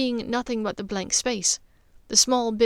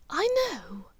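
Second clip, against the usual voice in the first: whisper; 9 semitones higher than usual. Acted. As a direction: breathe it gently, understanding. Hushed reply.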